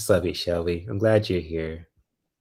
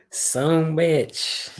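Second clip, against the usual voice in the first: put-on higher country accent, drawn out; 7 semitones higher than usual. Streamer repeating her catchphrase to viewers, playful country accent. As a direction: play up a country twang. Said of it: "And got the country accent".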